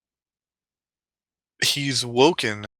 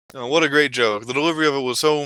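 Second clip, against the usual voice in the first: ordinary talk, nothing marked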